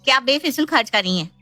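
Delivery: high-pitched tattling threat; threatening sister-in-law. Acted. Sister-in-law threatening to tell mother, petulant.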